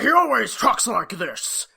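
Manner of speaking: loud cartoon-boss impression; Stan from Frisky Dingo. Shown as a demonstration. Host demonstrates how the character talks, loud put-on cartoon voice. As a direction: belt it out in a put-on voice. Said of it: "he sounds like Stan from Frisky Dingo".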